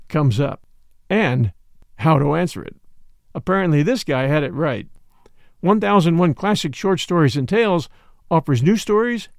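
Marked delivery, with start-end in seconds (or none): none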